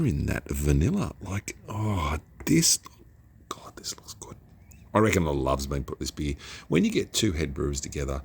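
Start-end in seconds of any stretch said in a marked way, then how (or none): 3.5-4.4 whisper. Real, not acted. Awed at the beer's look.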